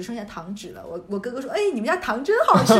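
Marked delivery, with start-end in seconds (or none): 1.5-2.7 laughing brag. Retold or imitated. Cousin brags about eaten candy.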